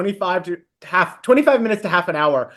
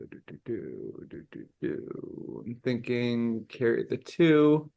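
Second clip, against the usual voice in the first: very soft, low mumbling to yourself; low, drawn-out thinking noises; mock calculating; 8 semitones lower, 12 dB quieter, slower than usual. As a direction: slowly pretend to work out a sum. Pretends to calculate an answer.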